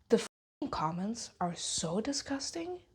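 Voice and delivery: slow disgusted whisper. Real, not acted. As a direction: let the disgust seep out quietly. Disgusted aside about comments.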